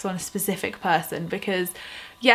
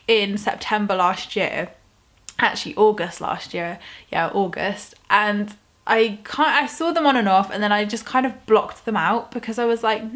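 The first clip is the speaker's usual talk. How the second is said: normal talking voice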